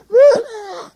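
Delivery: high loud laugh; real laugh. Real, not acted. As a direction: burst out laughing. Laughs at own boast.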